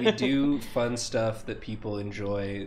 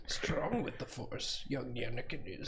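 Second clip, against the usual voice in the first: soft breathy Yoda whisper; 10 dB quieter than usual. Pretend. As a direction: hush it like old Yoda.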